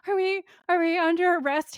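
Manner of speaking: high, stammering pretend freak-out; mock panic. Frightened Corbett facing the police, mock panic. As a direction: squeak it out like someone flipping out. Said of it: "He is flipping".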